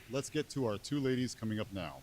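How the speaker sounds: ordinary talk, nothing marked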